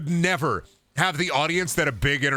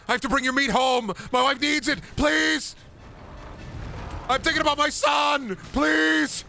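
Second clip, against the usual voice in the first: very high, shouted whiny pleas; pathetic begging; 14 semitones higher than usual. Pretend. Hunter pleading with the monster for meat for his family, high shouted plea. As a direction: beg desperately, voice up high.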